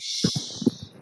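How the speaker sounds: a sharp whispered shh to silence someone; breathy shushing sound; shushing hiss. Retold or imitated. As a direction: hush them like God cutting you off. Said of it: "be like".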